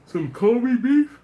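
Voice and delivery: slow, loud, high gush; excited. Real, not acted. Host fantasizes about Kobe beef, loud slow gush. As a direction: savor it, loud and drawn out.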